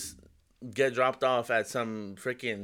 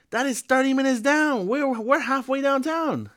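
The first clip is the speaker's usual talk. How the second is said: high-pitched, louder mimicked driver; driver's alarmed reply; 13 semitones higher, 3 dB louder than usual. Passenger retells the taxi driver's answer, high-pitched mimicry.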